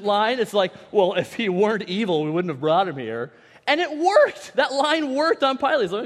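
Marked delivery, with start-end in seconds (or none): 0.9-3.3 loud, affronted comeback; indignant disbelief. Acted. Accusers answering Pilate's question, indignant. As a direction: answer as if the question were absurd. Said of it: "I can't believe you would ask me that question".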